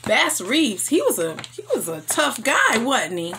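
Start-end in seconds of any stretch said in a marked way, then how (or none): none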